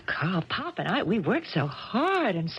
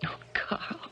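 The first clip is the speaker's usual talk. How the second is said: whispered, breathless name; tender whisper; 13 semitones higher than usual. Acted. Woman to her sweetheart, tender whisper.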